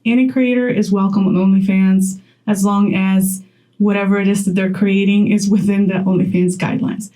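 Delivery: ordinary talk, nothing marked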